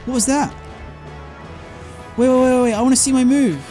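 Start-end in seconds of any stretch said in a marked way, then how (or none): none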